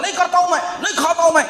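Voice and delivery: high-pitched mock refusal, no real yell; imitated loud child. Preacher imitating children refusing to do things or eat, mock-loud protest. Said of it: "بہت زور سے بولتے ہیں".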